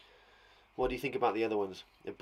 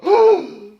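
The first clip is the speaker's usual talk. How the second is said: sharp loud horrified gasp; 15 dB louder than usual. Acted. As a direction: gasp in sudden horror. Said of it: "Rebecca gasped with horror".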